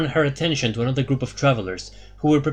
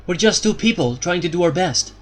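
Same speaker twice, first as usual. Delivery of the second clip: put-on lightly raised, uncertain young hero; young hero's voice; 6 semitones higher than usual. A narrator's reading.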